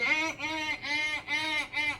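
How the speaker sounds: high nasal beeps mimicking a car horn; imitated horn honks. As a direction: beep like the horn.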